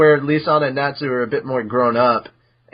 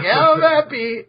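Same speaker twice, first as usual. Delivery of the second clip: much higher squeaky cat voice; Happy's voice; 13 semitones higher than usual. Acted. Happy forgiving Natsu over the fish, high delighted squeak. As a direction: chirp it like a delighted little cat.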